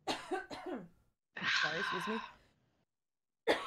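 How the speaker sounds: choking on water, coughing; strained after coughing on water. Real, not acted. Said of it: "Water went down the wrong pipe".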